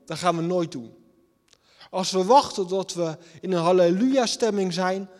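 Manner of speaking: normal talking voice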